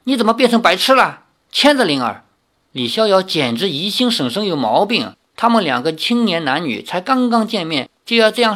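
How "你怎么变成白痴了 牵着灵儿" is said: high, quick scolding aunt. A narrator's reading. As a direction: snap at a hopeless nephew. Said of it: "李大娘骂道". (又高又快地责骂)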